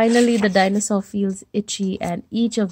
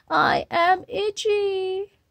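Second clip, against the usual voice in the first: high whiny plea; 8 semitones higher, 4 dB quieter, slower than usual. Acted. T-Rex pleads about itch.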